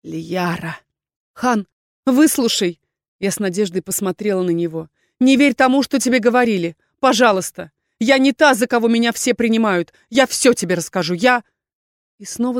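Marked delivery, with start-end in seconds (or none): 0.0-0.8 a touch of hopeless resignation; mild doomed utterance. A narrator's reading. Erkhan on seeing what she stares at, doomed. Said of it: "из его груди вырвалось обречённое". 1.3-2.8 slow, higher-pitched begging; urgent plea. Acted. Heroine begging Erkhan to listen, pleading. 5.2-11.4 raised, anxious plea to be believed; urgent pleading. Acted. Heroine begging Erkhan not to believe rumours, pleading.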